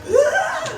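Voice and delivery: high, loud celebratory scream; retold scream. Actress retells screaming outside the supermarket, loud high shriek. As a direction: let out a big mock scream. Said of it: "screamed outside of the supermarket".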